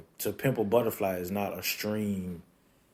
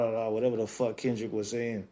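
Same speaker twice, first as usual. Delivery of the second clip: ordinary delivery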